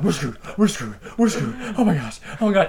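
mildly panicked repetition; retold freak-out. Driver realizing police are after him, mock panicking. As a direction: run through it as a retold fluster. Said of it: "begin to hyperventilate and freak out".

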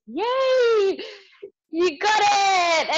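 high, loud, slow triumphant yell; excited cheer. Retold or imitated. Mum celebrates to the educator, a hammed-up delighted cheer. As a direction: cheer like she finally got it.

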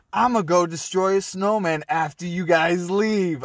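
high, loud mock threat; imitated rage. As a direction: taunt them, imitating an enraged coworker. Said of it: "she got so enraged".

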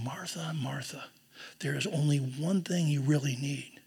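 tender whisper; deep love. Shown as a demonstration. Jesus speaking lovingly to Martha, tender whisper. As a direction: breathe it softly, full of love. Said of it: "He's speaking to her with deep love".

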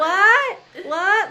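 loud, high, questioning "What? What?"; deaf grandpa shout. Retold or imitated. Grandpa who can't hear shouting at family, loud. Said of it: "He's like".

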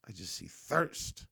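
ordinary delivery

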